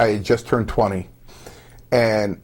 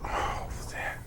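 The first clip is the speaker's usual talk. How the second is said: whispered curse; 16 dB quieter than usual. Retold or imitated. Retold dressing-room reaction, whispered.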